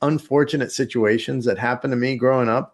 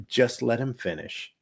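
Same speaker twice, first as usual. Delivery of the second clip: deadpan sister; 3 semitones lower, 8 dB quieter than usual. Retold or imitated. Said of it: "she goes".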